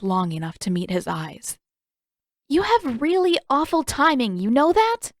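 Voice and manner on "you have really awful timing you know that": put-on much higher, exasperated girl's voice; Katara exasperated. Katara complaining about Zuko's awful timing, higher and exasperated. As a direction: roll your eyes at his timing.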